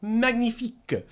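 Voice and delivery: high, theatrical French flourish; playful French connoisseur; mock French accent. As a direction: savour it in hammed-up French. Playful French echo of magnificent.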